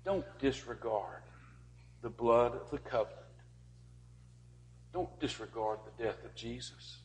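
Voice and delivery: soft, pleading, leaning on Don't; earnest soft plea. Acted. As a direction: beg them quietly not to forget. Pleading with congregation.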